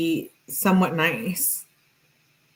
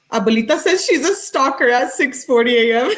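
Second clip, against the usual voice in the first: laughing through the words; cracking up while reading the comment; 9 semitones higher, 4 dB louder than usual. Real, not acted. Reading a viewer's comment aloud, laughing hard.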